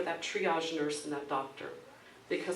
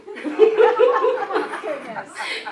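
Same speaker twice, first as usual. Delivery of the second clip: high burst of laughter; 14 semitones higher, 13 dB louder than usual. Real, not acted. Laughing at the story.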